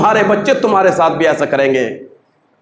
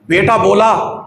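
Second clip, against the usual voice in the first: normal talking voice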